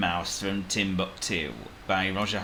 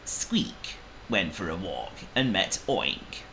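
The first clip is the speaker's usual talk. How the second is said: ordinary delivery, nothing marked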